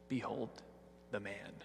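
Pilate's mocking "Behold the man", said soft and scornful. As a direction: sneer "Behold the man" in a hushed, contemptuous voice. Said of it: "they're mocking words".

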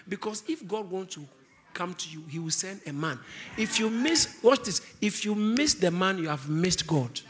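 usual speaking voice

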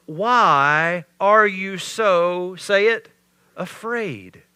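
slow, loud stretched "Why are you so"; drawn-out question. Shown as a demonstration.

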